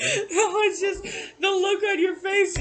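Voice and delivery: speaking through loud laughter; laughing. Real, not acted. Laughing while explaining.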